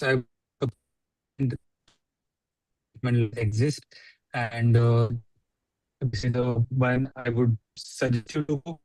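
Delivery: distorted video-call audio; bad connection. Real, not acted. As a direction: voice breaking up over call. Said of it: "I'm the only one who's not able to hear you well".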